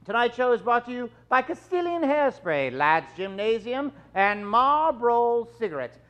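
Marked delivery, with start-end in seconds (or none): none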